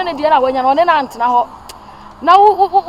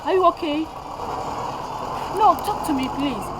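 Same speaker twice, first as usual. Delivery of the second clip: distressed pleading; 9 semitones higher, slower than usual. Acted. Mother pleading with her hurt daughter, frightened. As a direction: beg her to answer.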